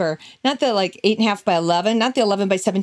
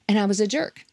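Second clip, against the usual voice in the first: whispered. Real, not acted. Confessing in a hushed aside.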